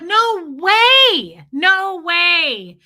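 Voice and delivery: high, drawn-out shout of disbelief; loud disbelief. Retold or imitated. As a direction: cry it out, stretching each word. Retells disbelief at cat found alive.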